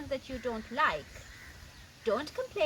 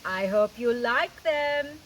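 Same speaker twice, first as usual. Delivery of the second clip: a low, slow, lightly voiced Jaguar; Jaguar's voice; 4 semitones lower, 4 dB louder, slower than usual. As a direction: read Jaguar a bit lower and slower. Jaguar voiced in read story.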